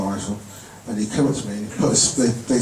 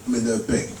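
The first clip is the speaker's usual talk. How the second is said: ordinary talk, nothing marked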